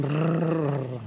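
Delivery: a long trilled Rrr rolled on the tongue. Shown as a demonstration. To the congregation, showing the trill, a long rolled Rrr. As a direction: roll the R in Rrr like a Spanish speaker. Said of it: "Try it".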